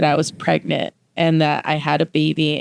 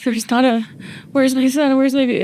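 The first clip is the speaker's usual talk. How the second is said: put-on high panicked calling for her baby; searching the room in a panic, pitch up high; frantic; 8 semitones higher than usual. Woke from a dream, calling for her lost son, high and frantic.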